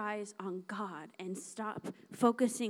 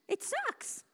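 emphatic complaint; 4 semitones higher, 4 dB louder, slower than usual. Acted. Preacher venting about feeling afraid.

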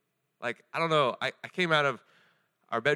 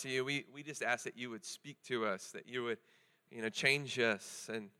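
soft, slowing prayer voice; 6 dB quieter than usual. Acted. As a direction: pray quietly over the room. Pastor opens with a prayer.

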